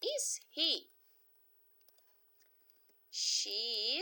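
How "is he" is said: "is he?" rising high as a question; high inverted question; question tune. Shown as a demonstration. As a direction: lift "he" up into a question. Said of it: "vamos perguntar".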